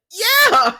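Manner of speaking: loud, high-pitched drawn-out outburst; mock exasperated yell. Fed-up Deacon answers Sheila, mock exasperated yell. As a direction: holler it, hammed-up and high.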